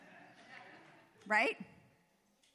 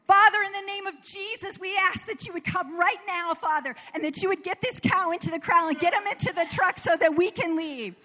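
loud, higher, urgent call to God; yelled prayer; 6 semitones higher, 4 dB louder than usual. Retold or imitated. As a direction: shout the prayer out across the field. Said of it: "So I yell out this".

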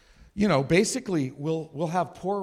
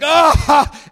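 sudden high loud yell of shock; loud cry; 12 semitones higher, 15 dB louder than usual. Retold or imitated. Steve reacting to the movie scene, with a loud high yell. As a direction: let out a startled shout. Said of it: "So he's like".

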